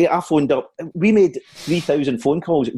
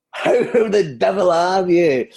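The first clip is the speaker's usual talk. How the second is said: very slow, higher comic greeting; Billy Connolly; 6 semitones higher, much slower than usual. Acted. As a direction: draw it right out as the comedian. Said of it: "It's, it's Billy Connolly here".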